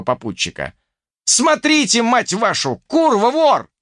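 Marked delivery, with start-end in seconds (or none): 1.2-3.7 high, loud cursing shout; yelling. A narrator's reading. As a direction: shout the alarm, high and angry. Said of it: "заорал я". (орать с руганью)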